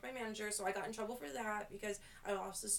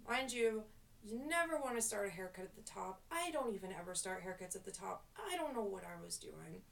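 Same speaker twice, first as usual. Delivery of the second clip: usual speaking voice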